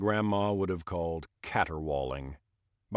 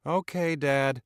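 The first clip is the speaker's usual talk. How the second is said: put-on young son's voice; 6 semitones higher, slower than usual. Son answers father.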